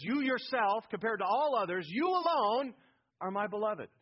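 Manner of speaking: "you alone" punched high and loud; very strong emphasis. Shown as a demonstration. Preacher stressing the Father's words to the Son, "you alone" very strong. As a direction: drive home "you yourself" and "you alone". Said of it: "This is very emphasis, very strong".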